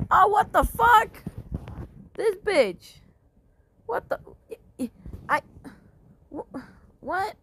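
shocked outrage. Real, not acted. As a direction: caught off guard, furious. Shocked by enemy attack.

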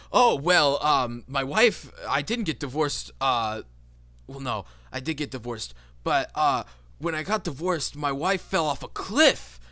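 loud, high, oddly upbeat guy; cheerful dude. Acted. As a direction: brag breezily about a tragedy. Second dude answers, voiced by GM.

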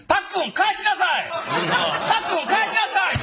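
frantic dad scolding; man scolding child. Retold or imitated. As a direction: yell at a kid in a store. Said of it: "おっさんの声で".

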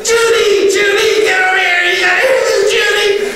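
high, loud laughing call through the house; yelling for wife. Retold or imitated. Neighbor calls his wife to hear the kid, loud and laughing. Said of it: "he's yelling in the house".

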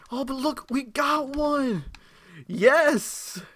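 high, drawn-out, loud cheer; excited celebration. Real, not acted. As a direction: crow with delight over the prize. Celebrates the drop once more.

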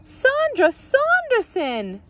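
very high, slow, drawn-out cry; starstruck exclamation. A narrator's reading. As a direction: exclaim it like an awed fan. Starstruck neighbour exclaims star's name.

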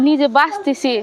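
ordinary talk, nothing marked

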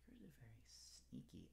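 unvoiced sneaky whisper. Shown as a demonstration. Teacher telling children tigers are sneaky, whispered. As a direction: hush it like a secret. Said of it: "but actually".

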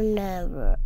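Never dropped low and firm, falling. Retold or imitated. Mommy ending her warning with a low, falling never. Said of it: "and yelled at me".

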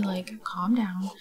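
slow unvoiced whisper, calm drawn out. Whispering to the angry sim to calm down, slowly. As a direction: hush the sim down softly.

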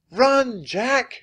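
high, loud warning shout; very high-pitched urgent yell. A narrator's reading. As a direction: shout a friend out of danger. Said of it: "shouted Annie".

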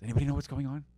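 normal talking voice

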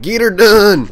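loud, high Texan holler; Texan yell. Pretend. Cheering a buddy on in a Texan holler. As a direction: whoop it like a rowdy Texan cowboy. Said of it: "we're like".

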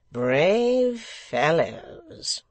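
high, loud, very slow commendation; pastor-like voice. A narrator's reading. Secretary commending them for valour, pastor-like. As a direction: stretch each word like a preaching pastor. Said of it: "pastor-like a voice".

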